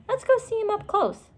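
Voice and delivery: put-on high, eager little-girl voice; bright childlike eagerness, pitched well up. A narrator's reading. Annie eager to see the dinosaur, high childlike voice.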